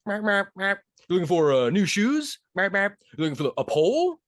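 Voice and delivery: high, coaxing Santa guessing wants. Retold or imitated. Santa guessing what the penguin wants, high and playful. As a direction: talk up high like Santa quizzing a pet.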